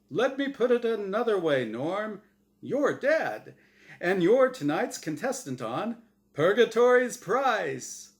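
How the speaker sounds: high, loud showman reveal; excited game show host. Acted. Host reveals Norm is dead, showman hype. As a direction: announce it like a prize.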